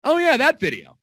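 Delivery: high, loud fake excitement; loud, high, hammed-up recognition; mock enthusiasm. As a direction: pretend you know exactly what he means. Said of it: "It's like, it's like".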